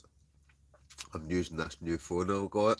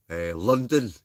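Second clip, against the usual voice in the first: excited; 4 semitones higher, 8 dB louder, slower than usual. Real, not acted. Reading comment names aloud.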